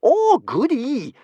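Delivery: high, slow gleeful; delighted. Acted. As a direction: relish the danger. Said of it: "smiled Kamikaze".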